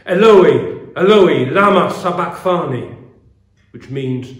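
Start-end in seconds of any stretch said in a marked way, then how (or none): 0.1-3.0 loud, anguished cry to God. A narrator's reading. Jesus on the cross calling to God, crying out loudly. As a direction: cry it out at full voice, each word ringing. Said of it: "Jesus cried out in a loud voice".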